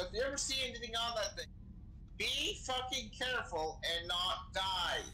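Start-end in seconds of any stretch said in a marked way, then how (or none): none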